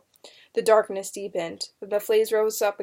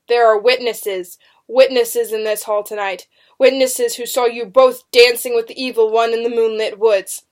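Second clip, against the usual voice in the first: loud, forceful prosecutor's charge; demanding accusation; 8 dB louder than usual. A narrator's reading. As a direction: accuse them before the whole hall. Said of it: "Benjamin demanded".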